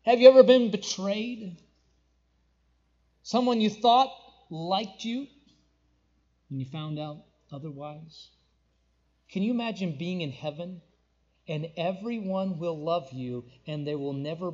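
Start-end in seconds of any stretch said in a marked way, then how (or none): none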